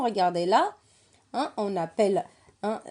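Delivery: ordinary delivery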